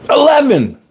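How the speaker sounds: ELEVEN pitched very high, then falling; emphatic exclamation. Real, not acted. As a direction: punch out "Eleven" high in amazement. Exclaims the number of years.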